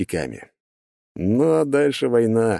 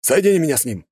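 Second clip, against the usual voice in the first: commanding; 8 semitones higher, 3 dB louder, faster than usual. A narrator's reading. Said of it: "приказал он".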